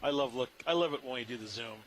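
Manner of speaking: ordinary delivery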